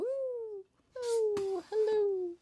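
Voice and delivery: squeaky sing-song hello; mock high greeting. Playfully greeting the camera in a high voice. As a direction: greet them in a silly high voice.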